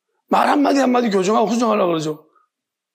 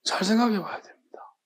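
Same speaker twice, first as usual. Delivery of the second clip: unvoiced whisper, leaning in; 7 dB quieter than usual. Real, not acted. Preacher whispering to the congregation to think. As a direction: breathe it like a secret.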